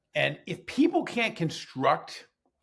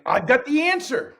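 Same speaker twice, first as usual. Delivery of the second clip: much higher, louder mock boast; mocking the boss; 11 semitones higher, 7 dB louder than usual. Teacher mocking his boss's confident claim, high and loud. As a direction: parody the boss's silver-bullet confidence. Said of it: "You're trying, like".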